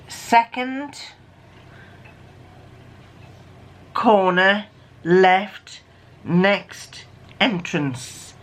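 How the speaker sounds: very slow, with long pauses between words. Shown as a demonstration. Reading out the decoded message to listeners, word by word. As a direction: read it out one word at a time, slowly.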